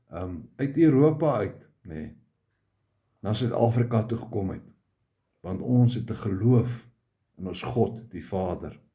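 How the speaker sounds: usual speaking voice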